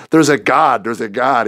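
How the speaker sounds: overjoyed news to the friend who discipled him; excited announcement. Retold or imitated. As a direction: blurt out the discovery. Calling his friend after conversion.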